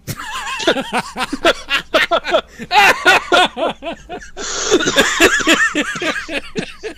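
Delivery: high wheezing fit of laughter; long helpless laugh. Real, not acted. Host reading a silly headline breaks down in high laughter. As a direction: lose it completely.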